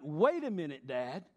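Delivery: put-on mildly indignant child objecting to dad; lightly acted, emphatic complaint; son's protest. Son objecting to dad that he's the one to forgive, mild indignant protest. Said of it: "He's gonna be like".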